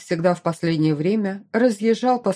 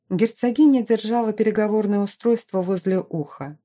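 plain reading voice

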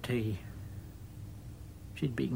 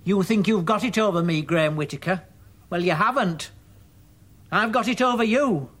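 loud, raised-voice challenge; shouting; 6 semitones higher, 6 dB louder than usual. Acted. Mother outside her son's bedroom door, shouting. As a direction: shout defiantly through a closed door. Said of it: "she comes shouting outside the bedroom door".

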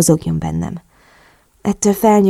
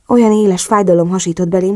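ordinary delivery, nothing marked

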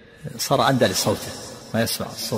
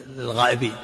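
ordinary talk

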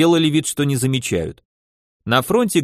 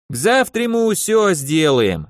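wheedling tailor with a Yiddish lilt; yiddish accent russian; 4 semitones higher than usual. Acted. Tailor reassures. (лёгкий идишский акцент портного)

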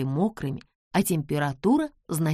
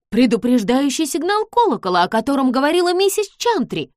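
louder, alarmed exclamation; exclaiming; 7 semitones higher, 7 dB louder than usual. Acted. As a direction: cry it out in alarm. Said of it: "воскликнула Джордж".

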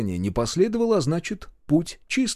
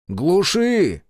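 raised, higher-pitched command; firm, loud instruction; first shouted order; 6 semitones higher, 5 dB louder than usual. A narrator's reading. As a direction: call out over the engine. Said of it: "громче повторил я".

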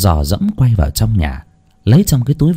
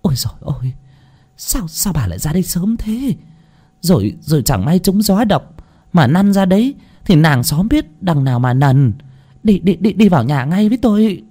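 flustered, higher-pitched neighbor voice; startled alarm; 7 semitones higher than usual. Acted. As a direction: fuss in alarm and herd her indoors. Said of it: "thì thảng thốt".

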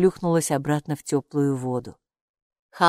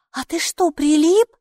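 anxious question, much higher and louder; worried; 11 semitones higher, 5 dB louder than usual. A narrator's reading. Girl worried the robe is stuck, anxious question. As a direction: worry tipping into alarm. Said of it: "забеспокоилась Майки".

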